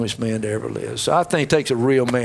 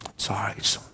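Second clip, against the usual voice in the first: whispered and soft; tender forgiveness; 4 semitones lower, 8 dB quieter than usual. Retold or imitated. Father welcoming his son home, whispered tenderly. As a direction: reassure him in a hush.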